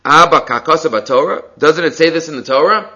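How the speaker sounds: "Abba" and "say" lifted in a questioning tune; read as a question. Shown as a demonstration. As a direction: ask it as a question, rising on "say". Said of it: "Rashi reads this as a question".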